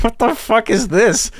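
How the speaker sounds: high, slow mock bewilderment; amused, drawn-out incredulity; imitated shocked disbelief. Acted shocked server reacting to yell.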